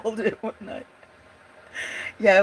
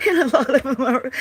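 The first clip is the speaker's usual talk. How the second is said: laughing; 6 semitones higher, 6 dB louder, faster than usual. Real, not acted. Laughing through her words.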